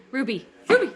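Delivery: calling a dog by name. Real, not acted. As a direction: call the dog's name loudly.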